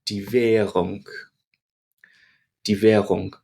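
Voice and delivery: "Währung" with the "r" barely heard, just bridging the vowels; soft German R. Shown as a demonstration. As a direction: let the "r" in "Währung" melt away. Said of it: "just bridging the sound between these two vowels".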